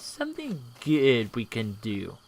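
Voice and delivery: ordinary delivery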